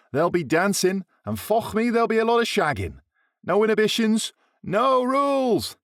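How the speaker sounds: high, gleeful lad voice; leering, over-the-top excitement; hyped up. A narrator's reading. Greaves hyped about spring break.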